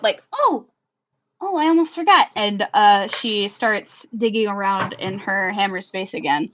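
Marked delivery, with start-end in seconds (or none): 0.3-2.3 higher-pitched sudden remembering; suddenly perking up. Acted. Tally suddenly remembers something in her hammer space, perking up. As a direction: perk up with a bright "oh!". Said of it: "like, perks up".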